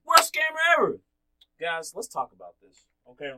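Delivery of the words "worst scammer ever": mocking verdict. Real, not acted. Mocking punchline.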